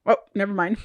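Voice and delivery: ordinary talk, nothing marked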